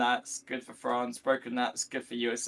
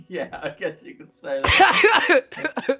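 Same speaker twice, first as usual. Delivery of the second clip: slow, laughing words, much higher and louder; 13 semitones higher, 11 dB louder, much slower than usual. Real, not acted. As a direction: laugh through the reply. Awkward reply to the joke.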